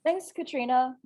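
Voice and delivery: ordinary talk, nothing marked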